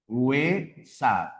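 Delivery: deshuesar in slow pieces des-hue-sar; syllable by syllable. Shown as a demonstration. Teacher showing the RAE split, slowly separated. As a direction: close the first syllable of deshuesar on S, pause, then hue, sar. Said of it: "la rae recomienda esta separación".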